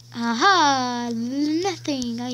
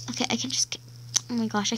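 breathy whispered worry; 2 semitones lower, 5 dB quieter, much faster than usual. Real, not acted. Kid stuck in game, whispering in worry.

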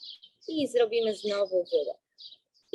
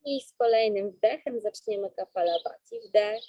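slow, calm breathing-coach voice; guided breathing. Acted.

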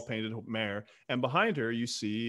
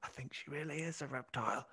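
put-on soft, hushed boy's half-whisper; sort of whispering; 2 semitones higher, 12 dB quieter than usual. Boy confides in his mother, half-whispering. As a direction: confide it very softly, like a child. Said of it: "sort of whispering".

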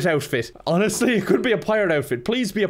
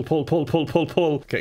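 normal talking voice

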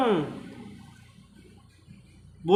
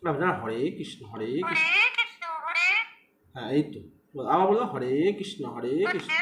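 soft coaxing; 4 semitones lower, 6 dB quieter than usual. Real, not acted. Coaxing pet to repeat the chant.

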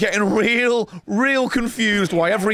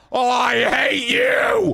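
high, drawn-out cry of hatred; frustrated shout; 6 semitones higher, slower than usual. Real, not acted. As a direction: wail it at the boss, stretched out. Outburst at game boss.

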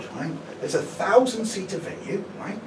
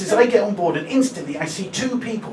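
usual speaking voice

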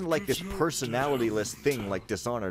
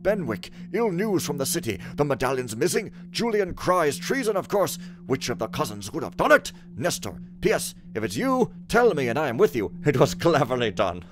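raised, loud theatrical reading voice; dramatic letter reading; 4 semitones higher, 6 dB louder than usual. Acted. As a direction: read the letter like a stage messenger. Reads in-game letter aloud dramatically.

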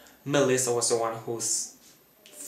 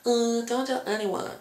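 much higher, put-on imitation of a young woman; faking Spencer's voice; 10 semitones higher than usual. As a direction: sneak the line in someone else's borrowed voice. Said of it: "fake her voice".

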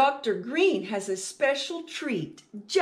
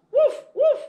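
high, loud playful puppy woof; puppy barking; 8 dB louder than usual. Acted. Teacher reading to children, barking as the puppy: high loud woofs. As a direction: bark like an excited little dog.

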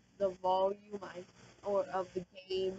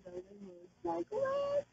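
baffled squeak; 12 semitones higher, 3 dB quieter than usual. Real, not acted. Confused by the game screen.

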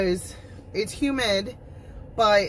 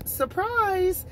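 high, drawn-out playful surprise; mock surprise; 12 semitones higher than usual. Real, not acted. Vlogger teases viewers with a slow, high mock surprise. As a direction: tease the reveal slowly.

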